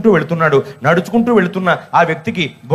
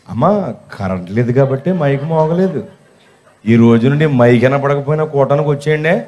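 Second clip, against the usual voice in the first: lower, restrained polite reply after hidden anger; outwardly polite; 6 semitones lower than usual. Retold or imitated. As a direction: answer calmly, pitched low, hiding the anger. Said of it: "లోపల అనుకుని బయటికి". (కోపం దాచి మర్యాదగా, తగ్గు గొంతుతో)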